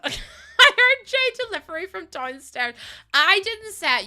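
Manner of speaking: blurting it out mid-laugh. Real, not acted. Laughing after name slip.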